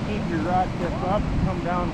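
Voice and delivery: loud coaching call; fighting a fish. Real, not acted. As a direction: call instructions over the river.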